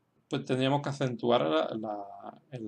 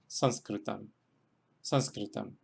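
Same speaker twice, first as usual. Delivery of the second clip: "Sanskritam" accented on SANS; teacher's careful "Sanskritam", SANS stressed; stress on first syllable; 4 semitones lower, 5 dB quieter, slower than usual. Shown as a demonstration. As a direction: model "Sanskritam", leaning on the opening SANS. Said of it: "es la primera sílaba".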